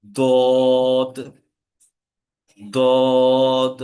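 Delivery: দদ loud and long, then দ, দি, দু each alone; isolated syllables from দদ to তুন, heavy and deliberate; heavy Dad drill. Shown as a demonstration. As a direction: recite দদ and its syllables with a thick d. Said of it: "আমি আবার বলছি".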